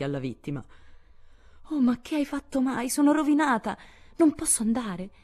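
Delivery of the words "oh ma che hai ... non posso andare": high, distraught wail of despair; a girl wailing over her burnt hair; wailing lament. A narrator's reading. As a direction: cry out as if ruined. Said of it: "gemette Meg".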